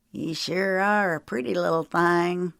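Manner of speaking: oily, creepy compliment; leering villain. A narrator's reading. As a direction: make her uneasy. Otis leering at Sarah.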